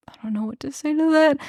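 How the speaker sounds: a hint of a stunned young man, quick and small; voiced Elias, lost. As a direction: mumble it fast, at a loss.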